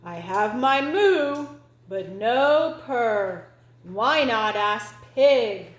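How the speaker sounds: loud, slow cow voice; loud drawn-out cartoon cow. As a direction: answer slowly and heavily as a cow. Cow answers kitten.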